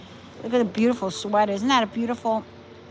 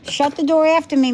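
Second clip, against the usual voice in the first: louder call to the house; 6 semitones higher, 8 dB louder than usual. Real, not acted. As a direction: call it out across the room. Calling out to wife.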